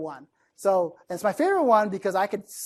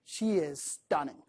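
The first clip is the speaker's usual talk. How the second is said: softer, drawn-out praise; slow, savoring emphasis; 4 semitones lower, 7 dB quieter, slower than usual. Acted. Speaker introducing a girl to a listener, slow and savoring. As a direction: linger over each word, slightly hushed. Example word set against plainer one.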